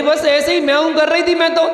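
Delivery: high, fast squeaky cat voice; cat's voice. Acted. The cat explaining herself to the dog, fast and high.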